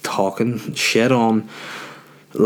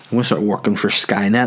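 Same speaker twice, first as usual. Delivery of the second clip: normal talking voice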